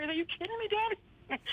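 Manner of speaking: high, incredulous; disbelieving, teasing her dad. Retold or imitated. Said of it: "And I'm like".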